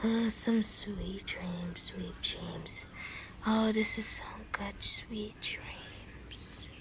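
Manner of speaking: very soft, slow sleepy whisper; sleepy murmur. Acted. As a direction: murmur it while drifting off. Sleeper murmurs about sweet dreams.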